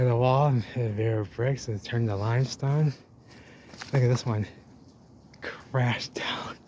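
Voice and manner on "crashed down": awed whisper. Real, not acted. Awed at the fallen wall.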